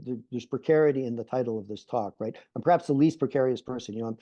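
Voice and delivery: ordinary delivery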